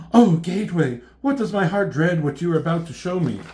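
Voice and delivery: hammed-up dread, loud on the opening; melodramatic dread. Elektra pleading with Gateway before a vision, hammed-up dread.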